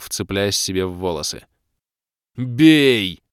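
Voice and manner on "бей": loud shouted command; battle yell. A narrator's reading. Ros ordering the attack, loud yell. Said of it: "завопил я".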